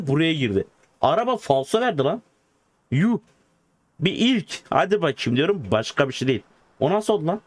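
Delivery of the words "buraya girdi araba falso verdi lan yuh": usual speaking voice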